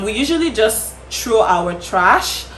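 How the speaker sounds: ordinary delivery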